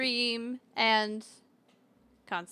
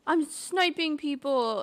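higher, drawn-out bragging; lightly mocked caller, slow and higher; imitated boy caller; 6 semitones higher, 4 dB louder, slower than usual. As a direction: draw it out like a caller boasting.

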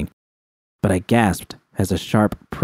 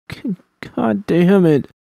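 slow, cracking curse pitched high; voice breaking; 11 semitones higher than usual. Acted. Alone and bleeding, cursing with a breaking voice. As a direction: let the curse break apart. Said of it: "I whispered, my voice breaking".

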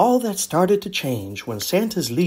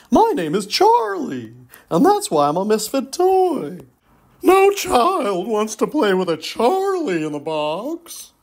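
higher, louder puppet voice; toy sentry; 6 semitones higher, 3 dB louder than usual. Acted. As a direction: announce yourself like a toy guard. Puppet explains.

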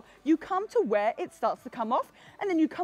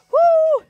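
high whoop; 11 dB louder than usual. Real, not acted. Fitness cheer mid-workout.

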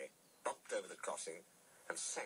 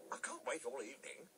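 higher, impatient complaint; pressed for time; impatient interruption; 7 semitones higher than usual. A narrator's reading. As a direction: cut in, eager to be off. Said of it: "Thomas interrupted".

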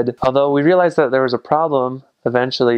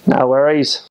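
Aussie accent; 2 semitones higher, 3 dB louder than usual. Shown as a demonstration. Showing viewers Aussie slang in a broad Aussie accent. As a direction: lay the Aussie accent on thick.

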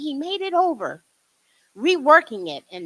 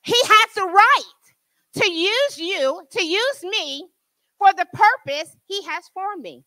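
louder, higher preaching build; full-force preaching; 7 semitones higher, 6 dB louder than usual. Acted. Preacher to her congregation, full-force preaching. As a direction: lift it up and drive it home.

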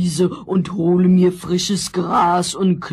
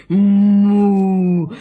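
cow moo; 2 semitones higher, 4 dB louder than usual. Acted. Cow character mooing.

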